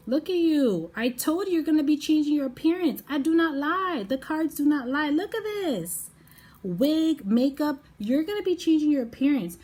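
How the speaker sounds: high, loud, triumphant excitement; loud, high-pitched hype; excited. Real, not acted. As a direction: exclaim it, thrilled to be proven right. Thrilled the card confirms her prediction.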